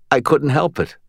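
usual speaking voice

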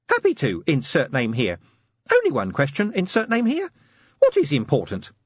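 high, chirpy little assistant; squeaky helpful gadget voice; tiny imp voice. Acted. Imp answers.

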